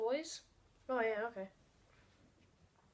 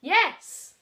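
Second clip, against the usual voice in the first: excited; 8 semitones higher, 13 dB louder than usual. Real, not acted. Celebrating a correct answer.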